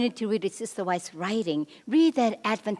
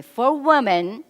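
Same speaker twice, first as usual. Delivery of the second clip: louder, higher, slow lead-in for an audience; call for repetition; 4 semitones higher, 7 dB louder, slower than usual. Shown as a demonstration. Seminar speaker cueing the men to repeat after her, leading call. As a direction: draw out "For women" with a rising end, cueing an echo. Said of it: "repeat after me".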